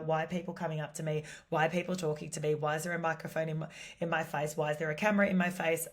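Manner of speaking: rapid, rattled mock Aussie stranger; quick mock panic from a wary local; flustered stranger. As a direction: fire off the questions fast, like a startled passer-by. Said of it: "the general consensus would be".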